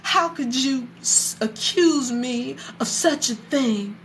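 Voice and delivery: imitating an affronted wife, drawn out; offended mother. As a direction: act aghast at the accusation, taking your time. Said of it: "Noah's mother was like".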